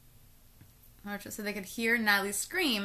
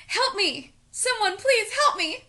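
high, louder mock cry for help; lady yelling; 12 semitones higher, 6 dB louder than usual. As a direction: call out like a woman pleading for rescue. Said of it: "a lady yelling".

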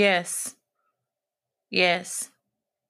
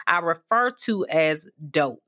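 ordinary delivery

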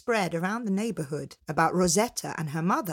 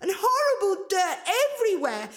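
very high bratty squeal; disgusted cry; 13 semitones higher than usual. Acted. Angelina complaining about the ogress's filthy house, disgusted. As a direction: recoil in high-pitched revulsion. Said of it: "Angelina cried".

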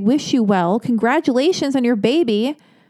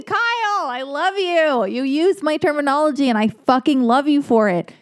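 excited; 6 semitones higher than usual. Real, not acted. Thrilled the writer used her word.

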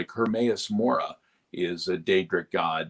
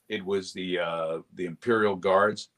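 usual speaking voice

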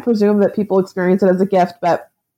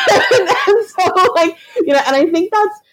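laughing; 11 semitones higher than usual. Real, not acted. Laughs after poop joke line.

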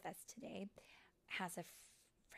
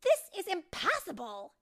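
high, loud outburst of exasperation; frustrated yell; 11 semitones higher, 16 dB louder than usual. Acted. Tinker Bell failing at light talent, exasperated yell. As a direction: yell it as you give up. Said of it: "she yelled".

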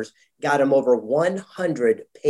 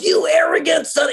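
sharply higher, louder angry yell; retold angry yell; 14 semitones higher, 4 dB louder than usual. Mentor yelling at his student for arrogance, mock angry yell. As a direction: bark it like an enraged mentor. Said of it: "He started yelling at me".

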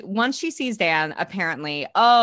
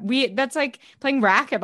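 normal talking voice

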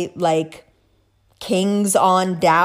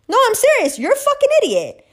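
emphatic outburst; 13 semitones higher, faster than usual. Real, not acted.

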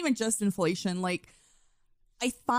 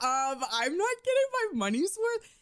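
whiny customer; 6 semitones higher than usual. Retold or imitated. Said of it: "I'm like".

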